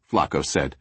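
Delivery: usual speaking voice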